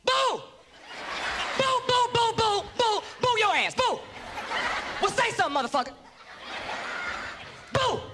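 high mock boos from the crowd; crowd booing. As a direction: jeer like a hostile crowd. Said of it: "Them motherfuckers booed me".

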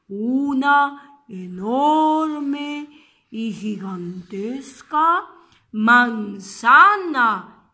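slow, drawn-out storytelling; suspense narration. A narrator's reading. Storyteller building suspense for the children, slow and drawn out. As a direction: stretch each word to build wonder.

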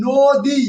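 "নদী" in two beats, "ন" then "দী"; syllable by syllable. Shown as a demonstration. Teacher counting syllables for students, "নদী" in two distinct beats. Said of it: "নদী কয়বার উচ্চারিত হয়েছে".

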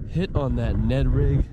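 ordinary talk, nothing marked

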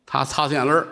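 ordinary delivery

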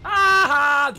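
loud high gleeful cry; triumphant shout. Real, not acted. Gamer nails an enemy, loud triumphant shout. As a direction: whoop at the kill. Scores a kill.

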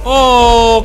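loud, high startled oh; sudden loud, high gasp of surprise; surprised oh. Real, not acted. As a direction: react in real shock. Enemy regains health.

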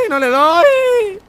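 high drawn-out crying whine; frustrated wail. Real, not acted. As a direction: wail it like a sob. Keeps missing shots.

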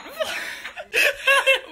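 high giggling laugh; burst of laughter. Real, not acted. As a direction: break into a laugh. Laughing at the failed cake.

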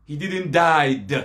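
slow, "died" drawn out to a hard d; stress on "died". Shown as a demonstration. Debater rejecting the rival's wording, "died" stretched with a hard d. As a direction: stretch "died" and pop the final d. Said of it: "Not that the day that I died".

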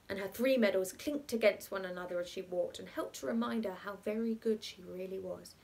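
ordinary delivery

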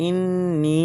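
ইন with a hummed n, নি held long; madd stretch on নি. Shown as a demonstration. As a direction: stretch নি out for three beats. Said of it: "মধ্যে মুনফাসিল তিন আলিফ".

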